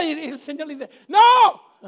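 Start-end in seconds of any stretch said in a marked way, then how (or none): 1.1-1.8 much louder, higher sharp No; emphatic no. Acted. The Lord stopping the father from coming, sharp and loud. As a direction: cut him off hard.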